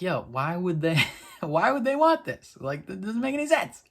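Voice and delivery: mock a baffled friend, laughing through it; lightly voiced Lila asking why anyone would want this; incredulous Lila. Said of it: "gotta be like".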